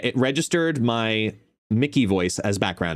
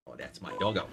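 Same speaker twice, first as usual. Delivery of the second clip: low, soft affection; doting pet talk; 6 semitones lower, 12 dB quieter, faster than usual. Real, not acted. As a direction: coo softly and low to a dog. Doting on the dog.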